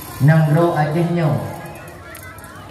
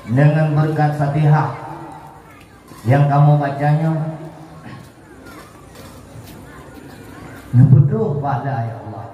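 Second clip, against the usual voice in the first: leading a prayer. Real, not acted. Leads the closing prayer.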